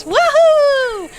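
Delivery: high, loud joyful woohoo; excited whoop. A narrator's reading. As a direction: whoop for the thrill of it. Duck thrilled on tractor.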